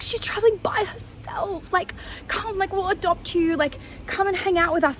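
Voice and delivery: high-pitched imitation of drunken concern; pitying drunk. Drunk man offering to adopt a solo traveler, high mock pleading. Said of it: "he was fucked up".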